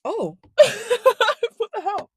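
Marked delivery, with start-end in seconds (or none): 0.6-1.5 a bright burst of laughter, high-pitched; sharp high laugh. Real, not acted. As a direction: laugh out suddenly in surprise. Sudden laugh after apologising.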